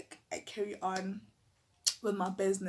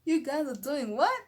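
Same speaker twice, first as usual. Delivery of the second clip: higher, louder disbelief; astonished higher query; shocked question; 5 semitones higher, 6 dB louder than usual. Retold or imitated. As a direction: catch them in the act. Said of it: "I'm like".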